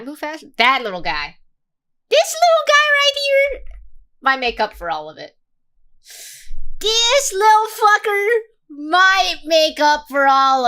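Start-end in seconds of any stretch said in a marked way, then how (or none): 2.1-3.6 cutesy coo. Real, not acted. Streamer cooing over a cute little game character, high and squeaky. 6.8-10.7 cutesy coo. Real, not acted. Streamer fondly squealing at the little game character, high. Cooing at cute game kid.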